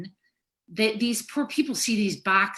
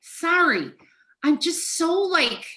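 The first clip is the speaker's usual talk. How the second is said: high, distraught exasperation; upset bursting out, then apologizing for it; overcome with emotion; 9 semitones higher, 3 dB louder than usual. Real, not acted. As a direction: struggle to get words out, voice very high. Breaks down, apologizes, is comforted.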